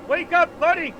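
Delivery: loud, high urgent call; shouting wake up. Pretend. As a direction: yell to jolt him awake. Gentle then shouted wake up.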